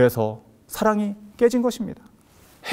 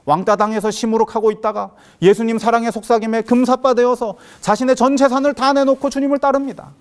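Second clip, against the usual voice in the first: usual speaking voice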